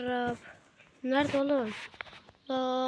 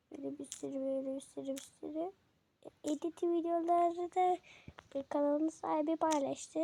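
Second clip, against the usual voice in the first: lightly raised, put-on girlish voice. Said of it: "beş dakikadır kız sesi yapıyordum". (hafifçe inceltilmiş kız sesi taklidi)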